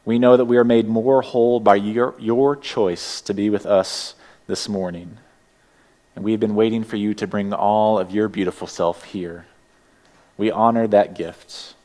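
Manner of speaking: ordinary talk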